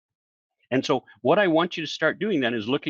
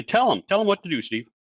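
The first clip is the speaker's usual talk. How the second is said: raised, pushy demand; insistent audience voice; 7 semitones higher than usual. Retold or imitated. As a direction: push him to just tell them. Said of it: "people say".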